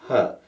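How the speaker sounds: short "huh" placed forward in the mouth; easy short "huh". Shown as a demonstration. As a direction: let out a little effortless schwa. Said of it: "an easy sound".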